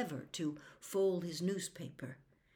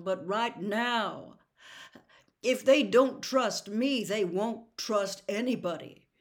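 earnest father pleading; 8 dB louder than usual. Acted. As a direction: press him to see your side. Atticus pleads with the sheriff.

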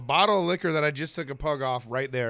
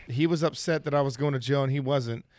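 normal talking voice

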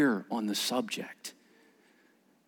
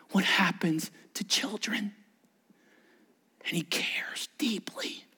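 hushed, heartfelt whisper; 7 dB quieter than usual. Real, not acted. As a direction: let it sink in softly. Tender point in sermon.

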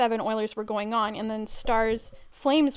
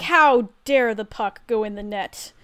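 slow, loud indignant quote; mock outrage; 5 dB louder, slower than usual. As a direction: imitate an indignant player, punching each word slowly. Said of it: "he immediately got mad about it".

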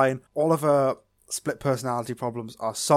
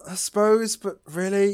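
incredulous; 7 semitones higher, 4 dB louder than usual. Real, not acted. Incredulous reply to co-host.

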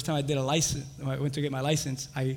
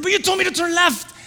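high, loud indignant comeback; acted-out heated complaint; retold protest; 8 dB louder than usual. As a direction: argue back, aggrieved. Acts out arguing back.

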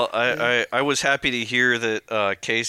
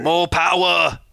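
high, loud mock-British boom; Jeremy Clarkson; 7 semitones higher, 5 dB louder, slower than usual. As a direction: bellow it in a hammed-up British accent. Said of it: "say that in the voice of".

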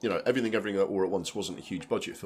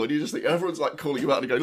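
ordinary talk

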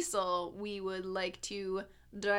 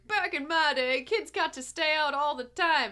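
boomer voice; 5 semitones higher, 6 dB louder than usual. Retold or imitated. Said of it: "boomers are like".

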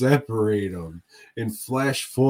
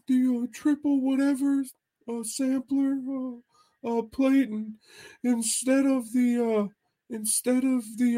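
much higher, goofy imitated friend's voice; Mahoney impression; 14 semitones higher, 5 dB louder than usual. Friend ordering appetizers from the waitress, high goofy imitation. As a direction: mimic a buddy's high, hesitant ordering voice. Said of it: "It's a good impression".

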